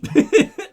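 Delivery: short high loud laugh. Real, not acted. Player laughs at his own choice, short and loud. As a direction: laugh at the strange sentence.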